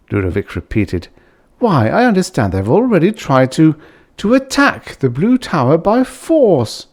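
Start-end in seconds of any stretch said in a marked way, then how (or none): 1.6-6.9 indignant protest. Acted. Ludovic protesting to the Belfin.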